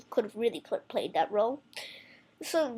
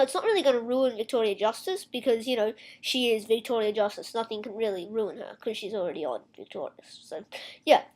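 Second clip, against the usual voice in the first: normal talking voice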